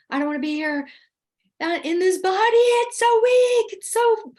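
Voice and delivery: high, loud cry climbing into panic; panicked scream. Retold or imitated. Reliving being trapped in the body under regression, panicked. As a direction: wail it, desperate to get out. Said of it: "I was screaming".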